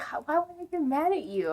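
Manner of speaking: high, quick mock-baffled question; puzzled dad. Dad reassuring his crying daughter, imitated bafflement. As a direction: imitate a dad taken aback.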